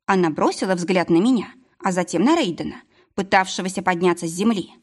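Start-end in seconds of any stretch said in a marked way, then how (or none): none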